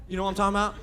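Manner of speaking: normal talking voice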